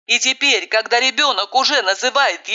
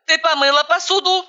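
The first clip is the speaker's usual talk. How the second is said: sternly questioning, higher; a bossy hands-on-hips demand; 8 semitones higher than usual. A narrator's reading. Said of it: "воинственно водружая кулаки на бёдра".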